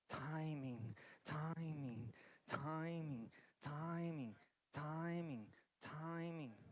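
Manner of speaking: "timing" repeated softly and low, over and over. Acted. Pastor leaving the congregation with one word, soft repetition. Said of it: "eu oro pra que você vá pra casa com isso".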